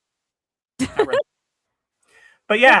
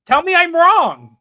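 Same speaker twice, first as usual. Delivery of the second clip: higher, louder, slow dare; mock challenge; 10 semitones higher, 8 dB louder, slower than usual. Husband daring wife to say he's wrong, high and loud. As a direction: tease her to deny it, slowly.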